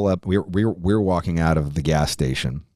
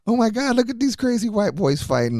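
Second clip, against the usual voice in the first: high, giggly mock voice of the girls; mock amused onlookers. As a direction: squeal it like amused local girls watching a fight. Neighborhood girls voiced laughing at fight.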